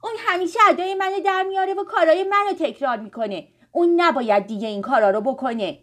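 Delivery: loud, high, indignant complaint; angry. A narrator's reading. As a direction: complain hotly about a copycat sister. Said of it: "آریان با عصبانیت گفت". (با عصبانیت)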